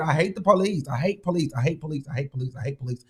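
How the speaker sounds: sulky refrain dying away; fading repeat. Real, not acted. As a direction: let it fade out. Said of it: "repeated line fading out".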